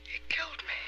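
whispered, drained disclosure; voiceless hush after an outburst; hushed revelation. Acted. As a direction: let it out in a whisper. Woman recounts her own murder.

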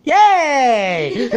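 high, loud cheer with a laugh; laughing cheer back at him. Real, not acted.

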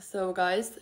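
usual speaking voice

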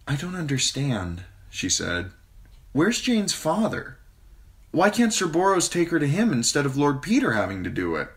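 put-on high girlish voice, puzzled; a girl not understanding the adults; confused young girl. A narrator's reading. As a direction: Sansa questioning the queen in confusion.

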